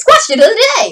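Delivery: high, loud segment call-out; announcing a segment. Real, not acted. Vlogger ending her monologue to launch the question, announcing. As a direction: sing out the title with a burst of energy. Calls out a show segment title.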